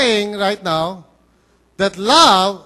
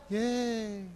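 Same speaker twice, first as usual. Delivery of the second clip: very soft, flat mock cheer; a deflated, sarcastic yay; unenthused yay; 13 dB quieter than usual. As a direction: teens unimpressed by a Bible study invite. Youths hear of Bible study.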